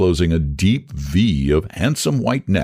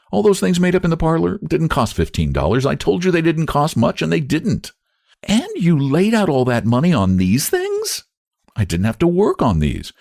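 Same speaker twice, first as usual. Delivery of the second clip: Sarah voice; 10 semitones higher than usual. A narrator's reading.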